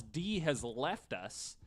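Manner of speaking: usual speaking voice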